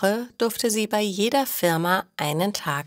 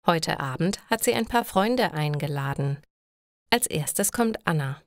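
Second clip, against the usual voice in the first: plain reading voice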